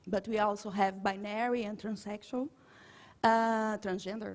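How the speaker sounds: ordinary talk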